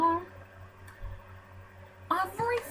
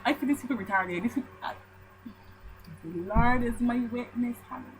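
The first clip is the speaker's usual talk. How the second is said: ordinary talk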